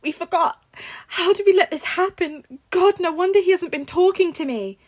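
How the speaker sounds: high, loud, tearful; aunt breaking down on the phone, high and loud; choking on a sob. A narrator's reading. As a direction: sob the words out. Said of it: "May choked on a sob".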